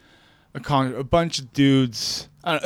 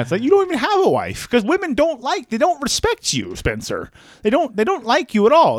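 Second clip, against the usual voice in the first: high mock Peterson voice, scolding; Jordan Peterson impression; 8 semitones higher than usual. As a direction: lecture the listener in the parody voice. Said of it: "the impression".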